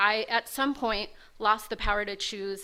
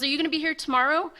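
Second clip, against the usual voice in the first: higher, louder and fast, bright and pushy; eager question; 6 semitones higher, 4 dB louder, much faster than usual. Retold or imitated. AA woman eagerly inviting newcomer back, higher and fast.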